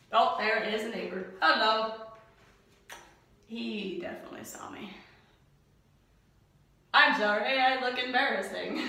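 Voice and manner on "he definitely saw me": embarrassed whisper. Real, not acted. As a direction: whisper it to the camera. Neighbor saw her.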